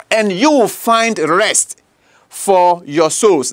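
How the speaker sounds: drawn out, very slow; slow emphatic reading. Acted. Preacher slowly reading the promise of rest to listeners. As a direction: let each word land slowly. Slowed scripture line.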